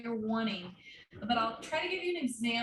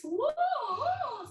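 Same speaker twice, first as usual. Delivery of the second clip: whoa sung much higher, open; open yawny whoa. Shown as a demonstration. Teacher contrasts open head voice. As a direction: lift into a high open whoa. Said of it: "yawny, open, nice".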